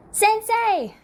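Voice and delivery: high, loud call across distance. A narrator's reading. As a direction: shout for the teacher. Students calling out.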